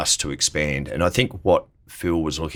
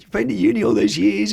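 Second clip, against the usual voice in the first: higher, louder imitation of a tearful mum; imitating upset mum; 4 dB louder than usual. Quoting his mum's tearful reaction, higher mock voice. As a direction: play mum, half-crying in disbelief.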